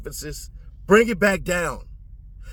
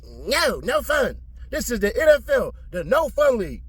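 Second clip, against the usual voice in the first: lightly imitated no-nonsense judge, pitched up; mock ruling in a raised pitch; mock killjoy judge; 7 semitones higher than usual. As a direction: mimic a judge shutting down the fun. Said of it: "your ass just sat up there and was like".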